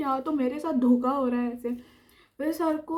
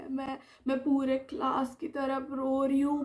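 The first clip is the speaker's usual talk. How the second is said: normal talking voice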